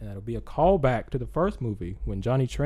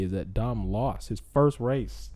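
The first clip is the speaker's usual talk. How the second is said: ordinary delivery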